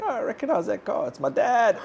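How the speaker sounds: high, hammed-up shock of recognition; mock alarm. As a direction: squeak it like a kid who just spotted dad's car. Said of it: "I'm like".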